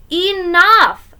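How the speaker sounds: high, loud interruption; exasperated outburst. Acted. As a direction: cut the kid off, fed up. Brother cuts off the recital.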